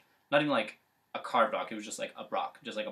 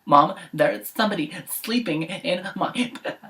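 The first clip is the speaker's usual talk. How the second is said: put-on higher childlike whine; baby bear whine; 7 semitones higher, 3 dB louder than usual. Baby bear telling mom about his bed. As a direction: tattle like a small cub. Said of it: "the baby bear goes".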